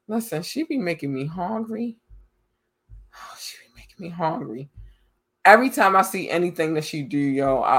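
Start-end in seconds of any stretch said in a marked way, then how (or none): 3.2-4.7 breathy whispered aside, much softer. Real, not acted. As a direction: whisper it like a craving secret. Repeats line in a whisper.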